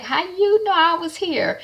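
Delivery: a light imitation of Cam caught off guard; surprised Cam.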